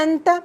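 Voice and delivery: अंता, the n half swallowed into the t; nasal n in anta. Shown as a demonstration. Teacher to class: अंता with the n hidden, as in पंखा. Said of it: "जैसे पंखा में नून पे थोड़ा अख्फा".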